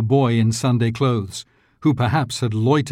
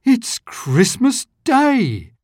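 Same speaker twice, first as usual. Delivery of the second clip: to himself; 12 semitones higher, much slower than usual. A narrator's reading. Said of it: "said Scrooge to himself".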